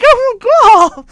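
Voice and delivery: high, loud cry of dismay; retold shocked exclamation; dismayed outburst. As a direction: exclaim in alarm, big and high. Reacting to the scene as retold.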